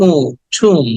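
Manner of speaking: "উ" held long, then "ঠুম"; drawn-out vowel. Shown as a demonstration. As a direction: stretch the oo into "ঠুম". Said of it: "উটাকে টেনে বলতে হবে".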